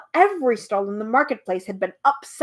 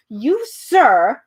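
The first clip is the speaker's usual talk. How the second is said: louder, agitated bee shouting; excited shout; 7 dB louder than usual. Acted. Bee confronts the humbug, louder excited shout. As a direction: fling the accusation out in a fluster. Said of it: "shouted the bee very excitedly".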